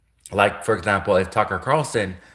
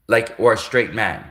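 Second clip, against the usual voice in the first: ordinary talk, nothing marked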